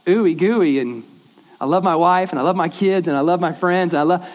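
lightly mocking a mushy love speech; mock sappy gushing. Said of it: "To which I'm like".

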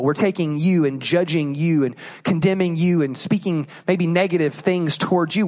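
ordinary talk

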